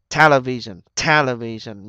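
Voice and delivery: Television with TEL punched; stress on first syllable. Shown as a demonstration. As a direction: hit TEL hard in Television, then fall. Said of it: "nhấn âm một ha". (nhấn mạnh âm một Television)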